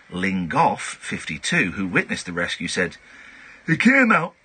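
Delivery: measured newsreader delivery, lightly mocking; news read. Host reading a tabloid story on air in newsreader style. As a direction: read the odd story like a bulletin.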